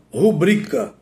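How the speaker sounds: "rubrica" stressed on the middle syllable, ru-BRI-ca; tônica correta. Shown as a demonstration. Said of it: "Qual é a forma correta?". ("rubrica" com tônica no BRI)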